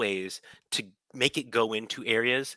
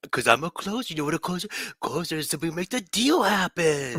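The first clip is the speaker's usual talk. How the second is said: high mock talking-to-a-toddler voice; mimicked baby talk; 7 semitones higher than usual. Co-host echoing the closer speech, high mock baby talk. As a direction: playfully parrot the baby-talk salesman.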